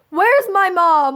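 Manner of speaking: high, drawn-out mock bewilderment; playful stunned question; retold disbelief. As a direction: stretch it out high, incredulous. Disbelief at supportive mom, retold.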